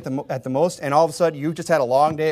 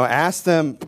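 usual speaking voice

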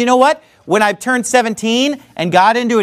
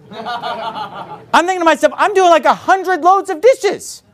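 laughing while retelling; 7 semitones higher, slower than usual. Retold or imitated. Laughing at memory of dishes.

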